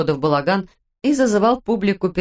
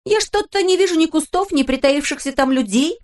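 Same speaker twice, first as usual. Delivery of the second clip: mock grumble; 9 semitones higher than usual. A narrator's reading. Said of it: "проворчала Пеппи".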